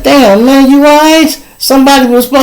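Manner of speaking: mild alarm. Retold or imitated. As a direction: shaken concern for his wife. Checking on wife after nightmare.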